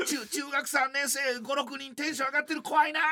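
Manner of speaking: high, hammed-up timid inner voice; mock timid fear. Inner voice dreading the rowdy boys, high mock fear. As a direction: play a scared grown man, half joking. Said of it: "っていう".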